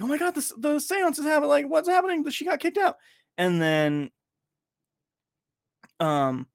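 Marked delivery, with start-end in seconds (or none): 0.0-3.0 high, excited mock panic; panicked friends. Friend imitating the panicking Zoom group, high and excited. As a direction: squeal it like everyone's freaking out. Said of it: "everyone's like".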